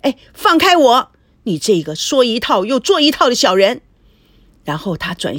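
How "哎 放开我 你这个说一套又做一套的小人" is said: high, loud furious yell; wife thrashing in his arms, shouting at him; angry shout. Acted. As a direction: scream to break free. Struggling wife screams at husband.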